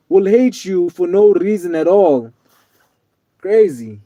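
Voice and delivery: usual speaking voice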